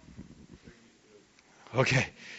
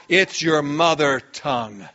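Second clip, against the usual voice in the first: ordinary talk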